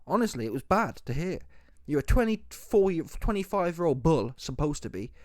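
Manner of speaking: normal talking voice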